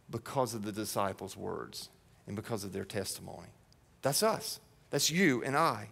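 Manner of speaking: soft, close, intimate; hushed aside. Real, not acted. Pastor quietly telling the church Jesus prays for them. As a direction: lean in and speak softly.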